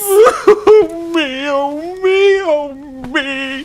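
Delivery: high loud crying wail; a fawn howling with grief; wailing sobs. Acted. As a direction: break down into helpless sobbing. Fawn bursts into sobbing.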